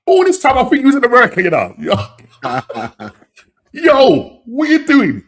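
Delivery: very high, loud incredulous outburst; high-pitched, booming disbelief; real astonishment. Real, not acted. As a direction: exclaim as if you cannot believe the news. Astonished by viewer's reply in chat.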